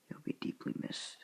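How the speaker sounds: ordinary delivery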